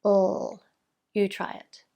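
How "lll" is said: "L" as one sustained, voiced sound; long L sound. Shown as a demonstration. Speech teacher modelling the sound for a child, long voiced "L". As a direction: hold the "L" with tongue tip behind top teeth. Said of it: "I call the L sound a long sound".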